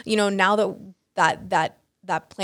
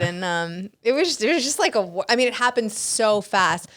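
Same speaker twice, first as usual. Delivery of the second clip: usual speaking voice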